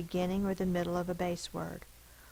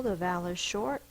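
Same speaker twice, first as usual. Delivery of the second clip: ordinary delivery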